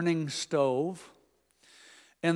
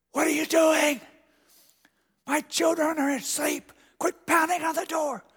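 high grandma-in-church stage whisper; 10 semitones higher than usual. Retold or imitated. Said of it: "a stage whisper".

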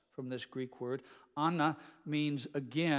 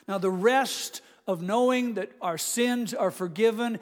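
louder, higher pulpit appeal; fervent exhortation; 5 semitones higher, 8 dB louder than usual. Acted. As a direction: press the point home. Preacher's closing exhortation.

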